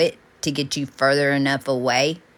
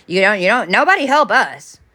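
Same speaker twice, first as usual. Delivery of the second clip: louder, higher angry rant; indignant outburst; 6 semitones higher, 6 dB louder than usual. Real, not acted. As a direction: let the frustration burst out. Ranting about America.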